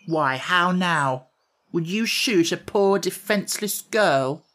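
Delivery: slow, wounded reproach; mock-hurt plea, drawn out; reproachful. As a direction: shame the soldier for pointing a gun. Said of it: "said reproachfully".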